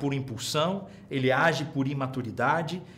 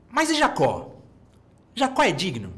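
emphatic question; 4 semitones higher, 4 dB louder than usual. Acted. Rhetorical question after pause.

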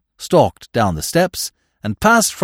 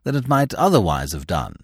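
plain reading voice

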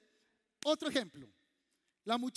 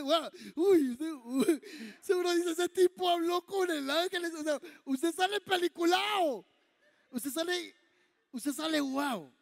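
higher, louder amazement; awed newcomer; 5 semitones higher, 6 dB louder than usual. Retold or imitated. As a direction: gasp in wonder.

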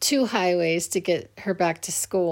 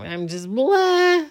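a wailing blah sob noise, higher, louder and slow; imitated sob; 8 semitones higher, 6 dB louder, slower than usual. Mother retelling her cry after a speeding ticket, mock sob wail. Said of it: "I'm just sobbing".

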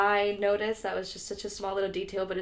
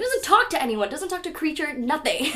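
high, emphatic indignant listing; exasperated disbelief; 7 semitones higher, 6 dB louder than usual. Real, not acted. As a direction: pile up the complaints, pitched high. Indignant listing of plot holes.

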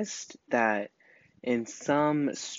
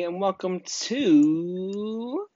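drawn-out announcer; 8 semitones higher, much slower than usual. Acted. Podcast intro, drawn out.

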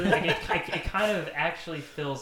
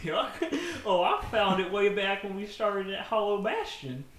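higher goofy cartoon voice; 7 semitones higher than usual. Goofy lying to Sora about the duck, higher voice. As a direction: chirp it out as Goofy.

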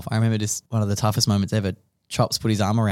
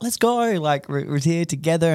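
imagined excited greeting; 7 semitones higher than usual. Retold or imitated. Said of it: "I probably wanted this big cuddle".

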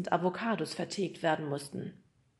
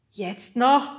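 louder, higher grumbled question; grumbling father; 5 semitones higher, 11 dB louder than usual. A narrator's reading. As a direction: grumble loudly in disbelief as the father. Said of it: "brummte Nemos Vater".

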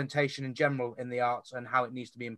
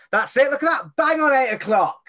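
excited; 11 semitones higher, 9 dB louder than usual. Real, not acted. Host notices time is up.

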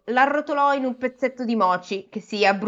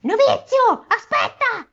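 high piercing witch call; shrill witch yell from afar; witch shouting; 14 semitones higher, 4 dB louder, slower than usual. A narrator's reading. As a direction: shout after him like a witch. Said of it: "la strega gridava".

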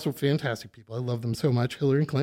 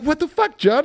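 friends in disbelief; 11 semitones higher than usual. Retold or imitated. Friends reacting to his state.

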